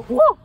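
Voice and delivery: sudden high, loud whoa; explosive mock yelp; demonstrated whoa. Shown as a demonstration. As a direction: burst out with a yelped whoa. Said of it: "you go".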